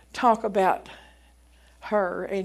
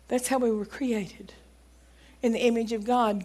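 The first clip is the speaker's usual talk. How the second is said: normal talking voice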